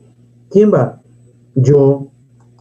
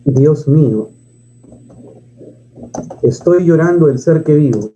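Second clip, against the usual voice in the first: poem recitation. Acted. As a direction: recite the verses gravely.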